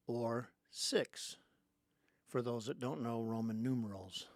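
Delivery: ordinary delivery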